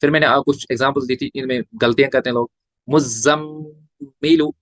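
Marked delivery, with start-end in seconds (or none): 2.9-4.5 मुज़म्मिलु very slow, mm hummed; slow recitation with ghunna. Shown as a demonstration. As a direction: draw out मुज़म्मिलु with nasal mm. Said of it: "यहाँ पे गुन्ना करना भूल जाते हैं". (गुन्ना के साथ धीमा)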